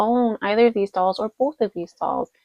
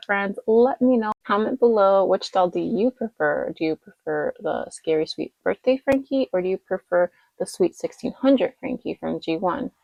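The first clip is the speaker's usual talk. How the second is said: ordinary delivery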